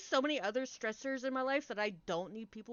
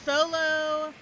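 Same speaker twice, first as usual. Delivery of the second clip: mock sing-song goodbye; 5 semitones higher, 7 dB louder, much slower than usual. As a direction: chirp it off and leave. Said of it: "And I'm like".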